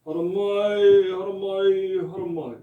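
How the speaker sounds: slow, loud ceremonial call; Māori welcome call, stretched slow and loud; threefold call of welcome. Shown as a demonstration. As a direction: call the welcome like an orator, fading by the third. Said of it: "See this?".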